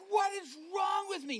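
high, loud cry of alarm; panicked yell. Retold or imitated. Preacher retelling his garbled speech, panicked yell.

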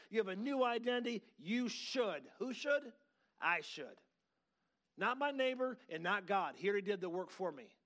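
high, emphatic pulpit declaration; forceful preaching. Real, not acted. Preacher telling congregation of their new identity, forceful preaching.

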